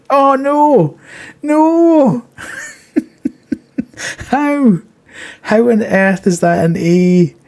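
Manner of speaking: high, loud disbelieving cry; shocked outcry. Real, not acted. Game result is only an A.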